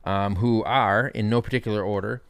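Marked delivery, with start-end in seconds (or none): none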